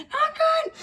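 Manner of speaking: a hammed-up high squeak of dismay; squeaky not good. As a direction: squeak it out comically. Retold alarm about seizures.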